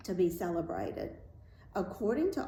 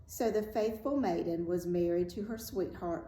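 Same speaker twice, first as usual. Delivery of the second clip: ordinary delivery, nothing marked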